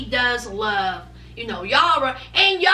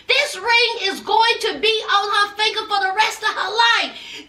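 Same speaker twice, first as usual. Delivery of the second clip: higher-pitched, insistent mother's lecture; mock motherly scolding; scolding mama; 6 semitones higher than usual. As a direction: act out a mama telling off her son. Voicing Jovi's mama scolding him.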